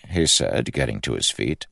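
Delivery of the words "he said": ordinary delivery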